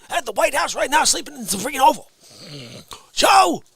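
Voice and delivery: normal talking voice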